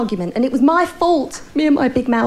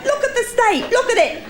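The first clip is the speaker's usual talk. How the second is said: laughing; 10 semitones higher than usual. Real, not acted. Laughing at her own act.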